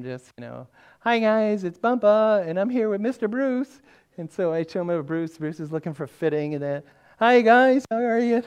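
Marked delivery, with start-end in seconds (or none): 1.0-3.8 high, loud greeting for little grandkids; cheery grandpa greeting. Retold or imitated. Greeting grandkids on FaceTime, retold. 7.2-8.4 put-on high, very loud hello to little kids; cheery grandpa greeting. Greeting grandkids on FaceTime, retold.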